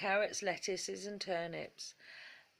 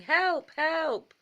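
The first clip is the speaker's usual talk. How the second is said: much higher, loud cry for help; frantic shout; 9 semitones higher, 7 dB louder than usual. Acted. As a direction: yell as if running for your life.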